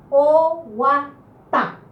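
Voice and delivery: Oguatá very slow, syllable by syllable, strong final "tá"; acento final. Shown as a demonstration. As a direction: stretch Oguatá out and land firmly on "tá". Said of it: "más fuerte en la última vocal". (Oguatá muy lento y silabeado, con la "tá" final fuerte)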